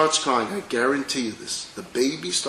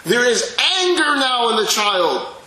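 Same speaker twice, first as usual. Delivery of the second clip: raised narration; 10 semitones higher, 6 dB louder than usual. Acted.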